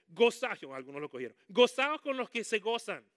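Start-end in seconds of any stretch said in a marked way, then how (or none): 0.1-0.6 a loud, high burst on gozaos; loud call. Real, not acted. Preacher calling the verse to the church, loud. 1.5-3.0 loud, higher preaching of the verse; loud proclamation. Real, not acted. Preacher proclaiming the verse to the church, loud.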